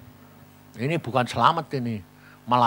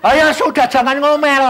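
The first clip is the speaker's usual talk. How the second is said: higher, louder call to hurry; loud urging; 11 semitones higher, 11 dB louder than usual. Retold or imitated. Speaker urging grumbling friend to drive him, loud. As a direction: hustle the grumbler along.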